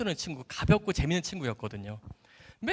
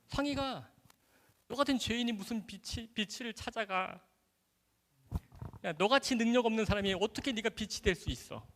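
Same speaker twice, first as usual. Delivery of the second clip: higher, softer belittling voice; evil inner voice; 5 semitones higher, 4 dB quieter than usual. Retold or imitated. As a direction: imitate a discouraging tempter, putting the listener down. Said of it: "나쁜 음성은 포기하게 만듭니다".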